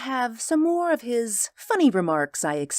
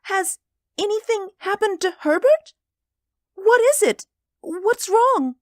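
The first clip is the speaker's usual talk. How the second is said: put-on very high, urgent old-lady voice, growing louder; panicked mother; 5 dB louder than usual. A narrator's reading. Old mother asking after Herbert, panicked. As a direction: build in alarm at a high pitch. Mother fears for her son.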